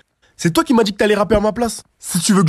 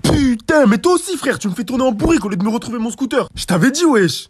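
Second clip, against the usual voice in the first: high-pitched exasperated complaint; fed-up griping at a friend; 6 semitones higher than usual. Acted. Quarrel over the lost scooter.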